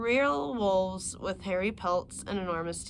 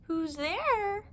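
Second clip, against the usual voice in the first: higher, put-on old lady voice; wolf trying to sound like grandma; wolf posing as granny; 12 semitones higher than usual. Wolf in bed answers the knock in a fake granny voice. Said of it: "the hoarse voice of the wolf".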